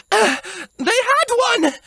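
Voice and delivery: high, panting mock shout; out-of-breath soldier reporting to the princess, high and urgent; breathless urgent soldier. A narrator's reading. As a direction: blurt it out between gasps. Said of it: "she shouted urgently through her gasps for air".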